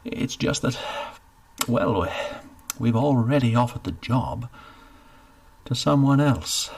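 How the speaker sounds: hesitant admission, still loud; awkward official confession; calmer steward. Acted. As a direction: break the news carefully. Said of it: "took a breath and calmed himself".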